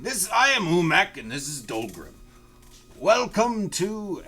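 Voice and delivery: high, loud merchant greeting; Umek greeting. Acted. GM voicing Umek welcoming the party, high and eager. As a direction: play the eager host Umek.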